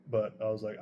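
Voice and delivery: usual speaking voice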